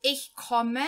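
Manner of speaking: slow, clear German read-aloud, each phrase set apart; slow learner reading. Shown as a demonstration. Teacher reading a German text to Portuguese-speaking beginners, slow and clear. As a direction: read it out slowly so beginners can follow.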